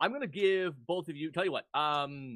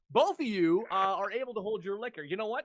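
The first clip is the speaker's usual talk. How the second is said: ordinary talk, nothing marked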